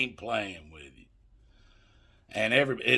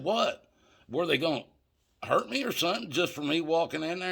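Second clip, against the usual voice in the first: higher-pitched incredulous questioning; retold disbelief; 8 semitones higher than usual. Teller asks dealer if he was in danger, incredulous. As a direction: re-enact dawning disbelief, voice jumping up.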